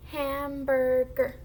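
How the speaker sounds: Hamburger slow, each syllable stretched. Shown as a demonstration. Teacher lets the class try blending, syllable by syllable. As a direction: draw Hamburger out in three slow beats. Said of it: "we are blending into three syllables".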